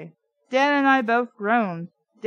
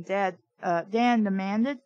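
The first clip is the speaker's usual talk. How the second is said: plain reading voice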